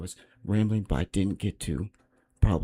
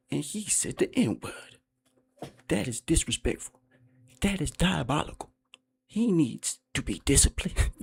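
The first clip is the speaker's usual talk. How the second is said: mocking the YouTuber's voice; 2 semitones higher, 4 dB louder than usual. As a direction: ham up the YouTuber's preachy talk. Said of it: "he talks like this".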